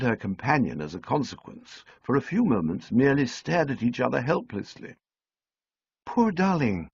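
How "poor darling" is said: higher "Poor darling" after a stunned pause; recovering speech. A narrator's reading. Sally finally speaking after staring helplessly. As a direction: find your voice again, pitched up. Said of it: "finding speech".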